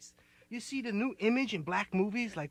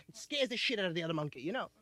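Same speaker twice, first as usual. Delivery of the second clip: normal talking voice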